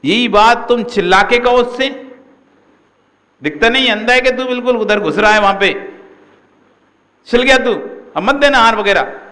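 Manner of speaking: high, loud yelled rebuke; shouting demo. Shown as a demonstration. Guru shows disciples how a shouted rebuke sounds, loud. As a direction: yell it right at him, furious. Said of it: "ऐसे चिल्ला के बोल दो".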